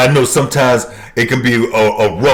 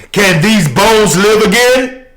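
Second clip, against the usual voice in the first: preaching; 10 semitones higher, 4 dB louder than usual. Acted. Preacher quoting scripture.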